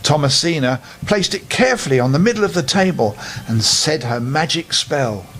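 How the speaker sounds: ordinary delivery, nothing marked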